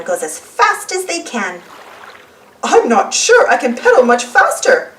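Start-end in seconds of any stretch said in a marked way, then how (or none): 2.6-4.9 puffing. Acted. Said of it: "puffs Daddy Pig".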